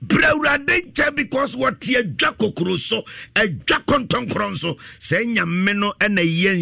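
high, slow, crying-out appeal; full-force preaching. Real, not acted. Preacher calls sinners back to the Lord, preaching at full force. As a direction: call out like a preacher at altar call.

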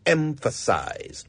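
emphasize said slowly, EM-fuh-size; slow teacher model of emphasize; slow careful emphasize. Shown as a demonstration. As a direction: model emphasize deliberately for the class. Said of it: "notice the way I use the word emphasize".

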